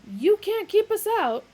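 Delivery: angry intruder yelling; shout. A narrator's reading. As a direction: yell defiantly. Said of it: "shouted the older one".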